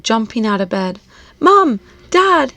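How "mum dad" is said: high, loud call for parents; crying out. Acted. Boy rushing downstairs calling his parents, loud. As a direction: shout for help down the stairs. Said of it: "he cried".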